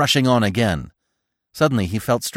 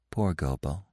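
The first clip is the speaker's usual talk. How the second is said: low, softly sighed sympathy; 5 semitones lower, 9 dB quieter than usual. A narrator's reading. Feline pitying absent Gobo to Bambi, softly sighing. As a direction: sigh it quietly and low. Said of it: "She sighed softly and said".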